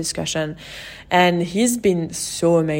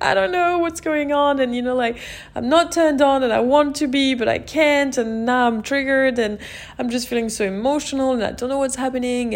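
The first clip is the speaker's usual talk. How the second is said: tearful; 6 semitones higher than usual. Retold or imitated. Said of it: "I burst into tears".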